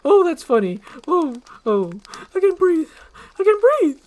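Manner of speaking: very high, loud; high loud relieved cry; gasping relief. Acted. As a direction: gasp it out.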